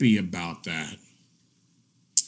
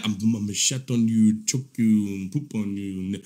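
low, softer mock threat, trailing off; imitating that guy; 7 semitones lower, 5 dB quieter than usual. Mocking that guy for the viewers, low fading imitation. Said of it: "You remember he was".

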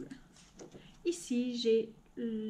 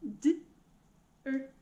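sounds split one at a time; robot voice; 3 dB quieter, much slower than usual. Shown as a demonstration. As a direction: chop each word into sounds. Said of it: "dire gant en robot". (voix de robot qui découpe les sons)

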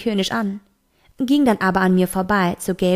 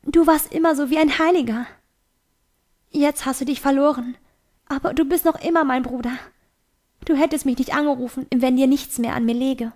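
higher-pitched, gentle appeal; pleading sister; 5 semitones higher than usual. A narrator's reading. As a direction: plead with a brother who has turned away. Sister pleads with her lost brother.

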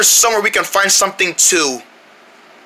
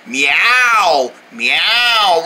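short, lower, simple meows; demonstrated cat meow; 5 semitones lower than usual. Shown as a demonstration. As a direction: show your own ordinary meow, pitched low. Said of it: "No, I meow like this".

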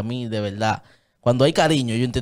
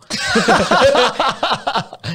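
loud high laugh bursting out; big burst of laughter; 13 semitones higher, 5 dB louder than usual. Real, not acted. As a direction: let the laugh explode after the punchline. Risa tras la cita. (carcajada fuerte y aguda)

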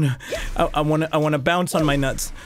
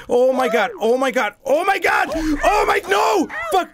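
panicked shouting; 8 semitones higher than usual. Real, not acted. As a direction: yell as it slips away. Falling again.

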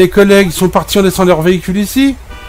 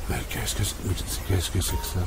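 much softer and lower, half to himself; baffled aside, much quieter; puzzled mutter; 7 semitones lower, 16 dB quieter than usual. Real, not acted. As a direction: mumble it under your breath. Discovers the scratch, muttering.

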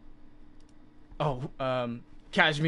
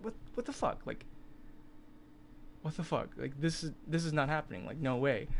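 in shock; 4 semitones higher, 3 dB quieter than usual. Retold or imitated. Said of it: "I was just in shock".

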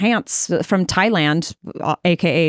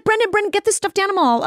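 imitating an eager Chrissy; 9 semitones higher than usual. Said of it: "She's like".

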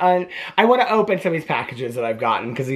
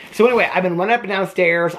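ordinary talk, nothing marked

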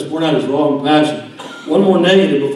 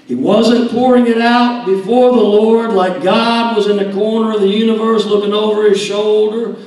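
louder, higher preaching to David at full force; fiery preaching; 6 semitones higher, 7 dB louder than usual. Acted. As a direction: drive it home like a revival preacher.

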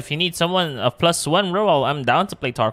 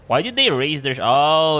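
ordinary talk

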